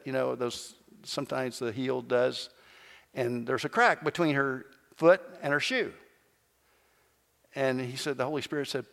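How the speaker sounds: ordinary talk, nothing marked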